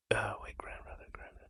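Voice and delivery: whispered, counting under her breath; whispered aside. Real, not acted. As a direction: whisper to yourself while working out the generations. Whispering aside while counting generations.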